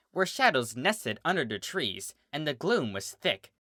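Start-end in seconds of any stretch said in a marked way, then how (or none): none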